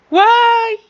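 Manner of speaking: very high, loud shriek; shouting. Pretend. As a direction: yell it out suddenly. Sudden yell in toy play.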